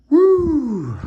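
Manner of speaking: high, loud whoop of relief; excited whoop. Real, not acted. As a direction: let out a big woo. Relief after finishing arm.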